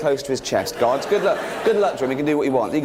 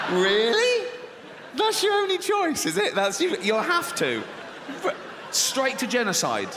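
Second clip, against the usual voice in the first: sarcastic scolding; 5 semitones higher, 3 dB quieter than usual. Acted. Scolds God for the flood.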